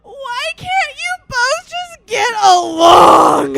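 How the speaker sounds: very loud, high, drawn-out imitated crying; mock drunken wailing. Drunk friend begging roommate and boyfriend, hammed-up wail. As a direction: wail it out slowly, hammed-up sobbing shout. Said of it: "She's crying".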